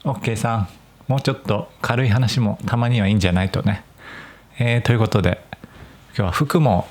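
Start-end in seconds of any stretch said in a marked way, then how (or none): none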